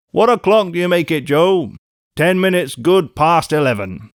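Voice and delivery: calling across the coach. Acted. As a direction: keep it loud and carrying.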